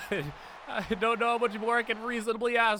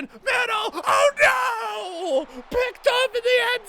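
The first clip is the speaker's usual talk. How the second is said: much higher and louder, crying out in dismay; anguished shout; 11 semitones higher, 7 dB louder, slower than usual. Real, not acted. Player watching his pass get intercepted: anguished yelling. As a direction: yell in horror at the pick. Opponent intercepts near the end zone.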